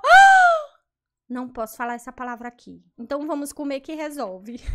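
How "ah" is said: shocked gasp. Real, not acted. Reacts to what she reads.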